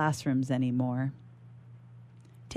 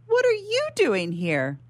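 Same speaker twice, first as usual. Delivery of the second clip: surprised; 13 semitones higher than usual. Acted. Shocked at grandmother appearing.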